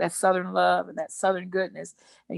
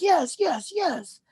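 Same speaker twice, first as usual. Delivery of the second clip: pitched-up eager yeses; eager Southern guy; 10 semitones higher, much slower than usual. Retold or imitated. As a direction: imitate a man agreeing eagerly. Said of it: "he was like".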